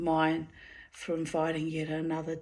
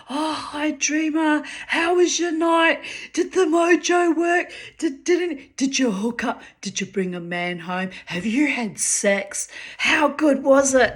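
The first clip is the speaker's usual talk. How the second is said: put-on high, eager girlfriend voice pumping for gossip; gossipy friend's voice; 8 semitones higher, 7 dB louder than usual. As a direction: voice the nosy friend, pitched high and loud. Friend Denature voiced in story.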